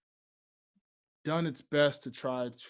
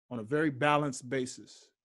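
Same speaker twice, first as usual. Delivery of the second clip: ordinary talk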